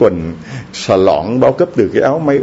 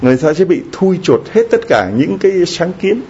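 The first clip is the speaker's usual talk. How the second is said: ordinary delivery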